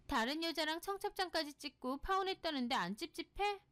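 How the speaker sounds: high, incredulous challenge; annoyed friend. Acted. Friend questions her about the fiancé's past, annoyed. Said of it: "소희가 좀 화를 내더라고요".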